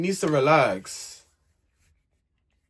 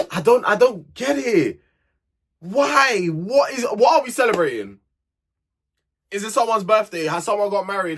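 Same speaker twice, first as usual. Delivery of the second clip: can't believe they're celebrating this; incredulous rant; 6 semitones higher, 3 dB louder than usual. Real, not acted. Vlogger baffled by islanders celebrating a kiss, incredulous.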